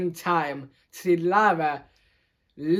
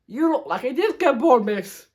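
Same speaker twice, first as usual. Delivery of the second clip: amused teasing; 7 semitones higher, 4 dB louder than usual. A narrator's reading. Pupil teasing teacher in story.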